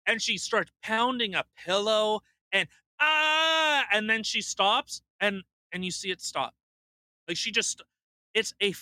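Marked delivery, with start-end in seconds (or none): 3.0-3.9 loud high fake outrage scream; mock outraged shriek. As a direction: ham up a staged freak-out yell. Acting out fake reaction video.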